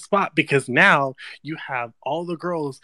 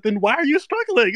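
quoting Daniel; 9 semitones higher, 6 dB louder than usual. Retold or imitated. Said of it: "and then he said".

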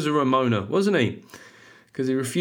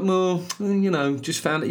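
defeated Brummie; 6 semitones higher, slower than usual. Retold or imitated. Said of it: "Brummies always sound a little bit defeated".

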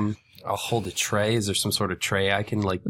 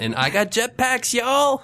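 excited; 3 dB louder, slower than usual. Real, not acted. Proudly announces jetpacks.

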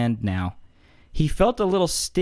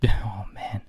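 whisper; 3 semitones lower than usual. Real, not acted. Laughing aftermath.

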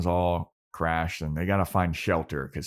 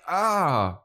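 high, drawn-out "ah"; mimicked long high wail; imitated sound effect; 10 semitones higher, 4 dB louder than usual. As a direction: let out a high, open cry in imitation. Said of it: "and then it went".

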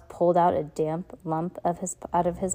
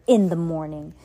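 angry, louder; 6 dB louder than usual. Acted. Captain Sham cutting off the orphans, angrily louder. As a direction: voice rising in anger, louder. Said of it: "his voice rising in anger".

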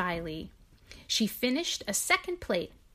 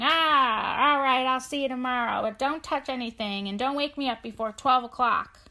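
a loud, goofy, hammed-up Mr Piperson, rushing the start; acting silly; 4 semitones higher, 6 dB louder than usual. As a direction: play the farmer as a silly, boisterous fool. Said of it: "kind of acting silly".

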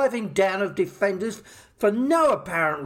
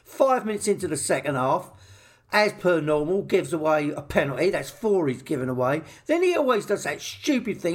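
ordinary delivery

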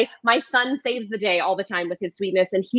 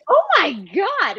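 mock shock; 10 semitones higher than usual. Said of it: "I was like".

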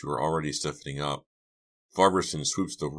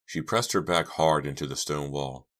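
ordinary delivery, nothing marked

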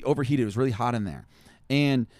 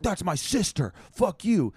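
angry yell; 7 semitones higher, slower than usual. Retold or imitated. Yelling at heckler from stage.

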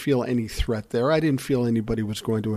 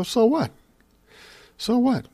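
much higher, dismissive retort; incredulous so what; 11 semitones higher than usual. Retold or imitated. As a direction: toss it back, pitched way up in disbelief. Brothers dismiss kid's worry.

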